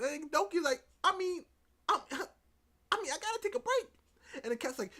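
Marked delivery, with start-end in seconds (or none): 1.0-3.9 loud, high-pitched faltering start; mock excuse-making. Doki telling Kat about needing a break, high mock-flustered voice. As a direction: squeak out a hammed-up excuse.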